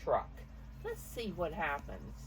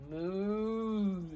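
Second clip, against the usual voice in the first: cow moo; 4 semitones higher, 5 dB louder than usual. Acted. Grandmother voicing Cow, long drawn-out moo. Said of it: "said Cow".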